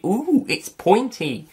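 high, slow comic caveman voice; funny caveman impersonation. Retold or imitated. Reviewer quoting a funny caveman from the film, high drawn-out mimicry. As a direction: draw it out high, imitating a silly caveman. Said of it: "one of them was very funny with the".

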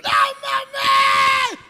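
very high, full-blast scream; drawn-out comic scream of alarm; panicked yell. Acted. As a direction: howl it out as the peanut jams. Man with peanut stuck, panicking.

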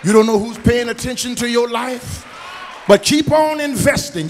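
rising shout of a preacher; preaching at full force. Acted. Pastor urging the congregation, preaching loud. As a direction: lift the church with a loud sung cadence.

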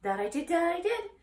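high, loud repeating goose. Acted. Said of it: "said the goose".

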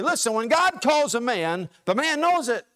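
high, loud preaching refrain; preacher driving home that the devil knows it; full-force preaching. Acted. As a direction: build it like a revival sermon, every line a hammer.

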